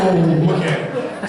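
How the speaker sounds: put-on slow, awed child's whoa; scared kids. Kids seeing the limo arrive, slow awed whoa. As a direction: stretch it out like a wide-eyed kid. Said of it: "They're like".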